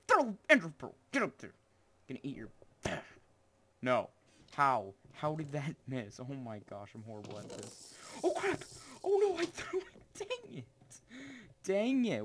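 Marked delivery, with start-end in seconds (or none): 0.1-1.5 higher, louder frantic shout at the game; frantic yell. Real, not acted. 8.2-12.2 much higher panicked yelp; panicked shriek. Real, not acted. Game mishap panic.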